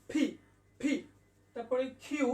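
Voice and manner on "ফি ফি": "ফি" with a strong puff on p; correct letter P. Shown as a demonstration. Teacher asking learners to listen to the voice, correct P. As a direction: aspirate "ফি" as p-h-ee. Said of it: "আমার voice থেকে শুনতে হবে".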